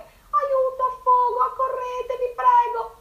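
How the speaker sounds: high, loud cries for help; panicked cries. A narrator's reading. Drowning rooster crying for help, high and loud. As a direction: yell for help while sinking. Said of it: "a gridare forte".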